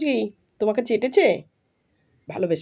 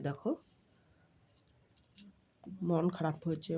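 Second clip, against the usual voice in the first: hushed confiding; 5 semitones lower, 9 dB quieter than usual. Real, not acted. Confiding to dog about sulking Miki.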